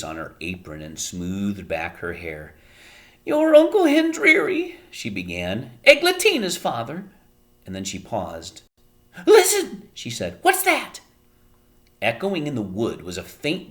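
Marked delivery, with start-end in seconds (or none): 3.2-11.0 high, loud, then sudden alarm; Homily voice. A narrator's reading. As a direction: stop and listen, startled.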